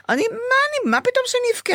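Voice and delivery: high-pitched amazed outrage at herself; indignant disbelief. Retold or imitated. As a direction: ask it as if it's absurd that you'd cry. Indignant at her own crying.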